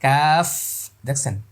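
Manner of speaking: ordinary talk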